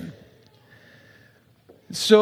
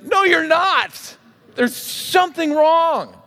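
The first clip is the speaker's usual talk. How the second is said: high, slow emphatic contradiction; drawn-out, higher-pitched protest; acted insistence; 7 semitones higher, slower than usual. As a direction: play a listener firmly setting them straight. Acting listener contradicting the contented winner.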